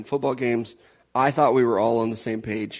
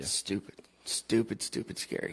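much softer, unvoiced mutter; muttered scorn; 2 semitones lower, 7 dB quieter than usual. Real, not acted. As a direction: mutter it under your breath. Mocking muttered repeat.

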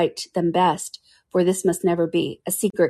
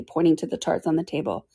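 ordinary delivery, nothing marked

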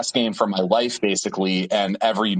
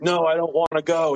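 tearful plea; 7 semitones higher than usual. Retold or imitated. Tearful goodbye with friend.

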